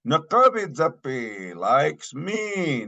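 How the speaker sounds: high, insistent "Nakabidzapi", then "me" stressed. Shown as a demonstration. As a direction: answer with emphasis, pitch lifted on "Nakabidzapi" and "me". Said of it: "More emphatic".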